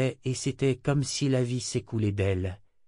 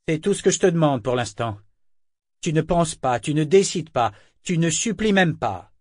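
contemptuous orders; 5 semitones higher, 5 dB louder than usual. Acted. Kénit belittles Yémin.

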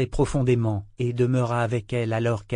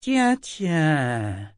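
higher, drowsy dragon voice; just woken up, sleepy; 5 semitones higher than usual. A narrator's reading. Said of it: "dit-elle d'une voix ensommeillée".

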